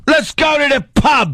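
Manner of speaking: high, hammed-up English-accented holler; mock English accent. Co-host hailing the intern in a high mock-English shout.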